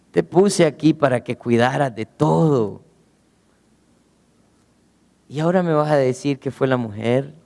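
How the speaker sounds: low disappointed God. Acted. God rebuking Adam.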